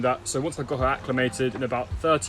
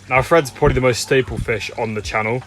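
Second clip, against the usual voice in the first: usual speaking voice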